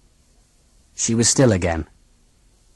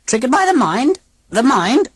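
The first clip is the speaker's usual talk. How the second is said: put-on high, stiff alien old lady; robot aunt voice; 13 semitones higher than usual. A narrator's reading. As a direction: speak like a cold machine aunt.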